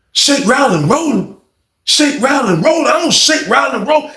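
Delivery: high, mock-offended yell; high, hammed-up outrage at the accusation; indignant mock yell. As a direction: ham up his offended denial. Pooh indignant, mocked by storyteller.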